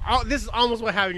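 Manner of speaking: normal talking voice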